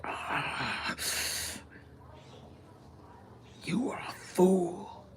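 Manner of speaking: menacing breathy whisper, slow; Voldemort whisper. Retold or imitated. Voldemort threatening Pauly Shore, in a sinister whisper. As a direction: hiss it like a dark wizard. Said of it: "He'd be like".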